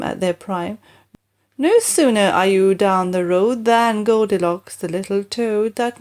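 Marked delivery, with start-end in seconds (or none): none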